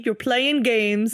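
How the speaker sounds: slow, drawn-out tease; slow teasing accusation. Acted. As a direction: stretch every word, teasing.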